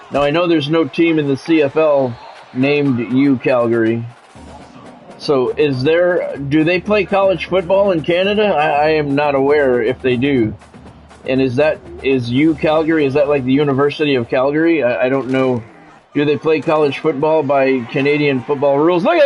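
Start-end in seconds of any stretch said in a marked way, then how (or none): none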